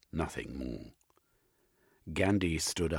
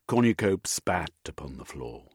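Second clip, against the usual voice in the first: ordinary delivery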